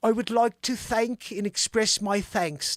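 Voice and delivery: slow attempt at English slipping into Cockney; Cockney-ish English accent. Host attempting the Queen's words, accidental Cockney accent. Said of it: "That's, like, more Cockney".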